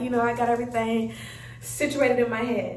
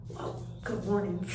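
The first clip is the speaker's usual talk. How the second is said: low, muttered, grudging reply; acted sullen morning mumble; grumpy greeting; 6 semitones lower, 10 dB quieter than usual. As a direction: answer quietly, clearly in a bad mood. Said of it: "I was so upset at the world".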